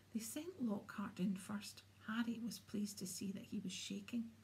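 ordinary delivery

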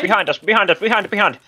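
loud high repeated warning; urgent warning. Real, not acted. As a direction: yell it to your team. Urgent warning of enemy behind.

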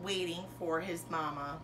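usual speaking voice

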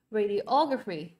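"radiography" slowly, stressed on OG; third-syllable stress. Shown as a demonstration. Teacher reviews the odd word out, third-syllable stress. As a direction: draw out "radiography" with the weight on OG. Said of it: "Câu số ba được chưa?".

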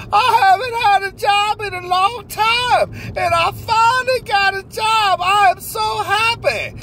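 high, slow, giddy voice of a man who just got a job; overjoyed new hire. Acted. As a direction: squeal it out like the happiest man alive. Character answers interviewer's question.

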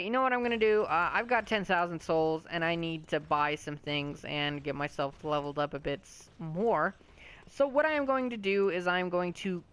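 ordinary delivery

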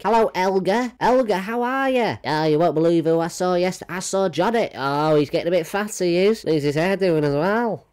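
hammed-up high gossip voice; gossiping old lady. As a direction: squeal the gossip like a nosy neighbour.

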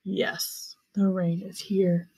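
lightly voiced lion purr; a hint of a low, purring lion; deep purr. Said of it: "spoke in a deep purr".

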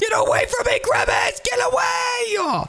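high, frantic shouting; panicked yell. Acted. Kid fleeing Grimace in the game, high panicked screaming. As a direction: scream him away in terror.

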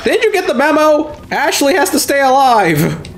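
high pitched scold; mock scold the character. Player scolding the dumb character in a high voice.

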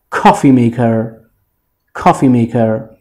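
کافی میکر with the first word accented, slowly; weight on کافی, میکر unstressed; stress on کافی. Shown as a demonstration. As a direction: hit کافی, let میکر fall away. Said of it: "بله رو کافی هستش".

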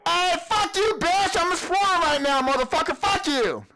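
high, defiant yelling at a monster; scared angry yelling. Real, not acted. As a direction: yell back at what scared you.

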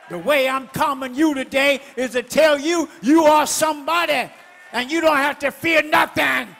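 loud, rising sermon cry; full-force preaching. Acted. Preacher stirring the congregation, loud and fierce. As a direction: preach it hard to the crowd.

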